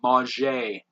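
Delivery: Mauger as mah-ZHAY; French pronunciation. Shown as a demonstration. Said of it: "pronounce it the French way".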